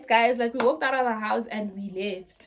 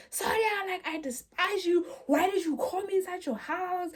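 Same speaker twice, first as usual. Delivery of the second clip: high-pitched, hammed-up outrage; retold accusation; 8 semitones higher than usual. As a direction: imitate a possessed friend accusing an elder. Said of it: "She's like".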